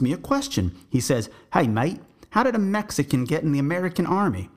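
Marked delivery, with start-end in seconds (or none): 1.5-4.5 put-on light Aussie accent, higher and quick; a hint of a blunt Aussie, pitched up; quick, pitched-up Australian mate; Australian guide.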